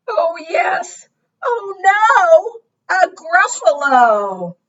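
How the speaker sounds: high, drawn-out dismay; high-pitched storybook panic; alarmed mouse. Acted. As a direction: wail it in dawning horror, ending long. Mouse meets the monster.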